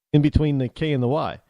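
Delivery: usual speaking voice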